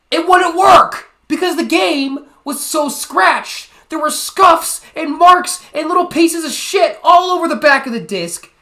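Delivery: high, loud outraged yelling; exasperated rant. Real, not acted. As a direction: shout each complaint in outrage. Ranting about the scratched game.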